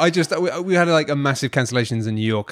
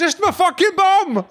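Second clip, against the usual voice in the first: mock panicked voice; slower than usual.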